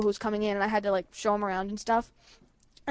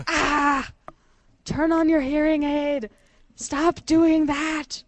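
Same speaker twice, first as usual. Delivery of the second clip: mock yell; 4 semitones higher, much slower than usual. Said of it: "I was yelling at him".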